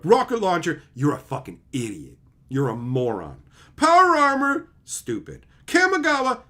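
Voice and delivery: a hint of a high, loud taunting voice; mocking critics. Imitating critics listing examples, partly high and loud. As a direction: swing up to high yelps on the names. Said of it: "That's what those people sound like to me".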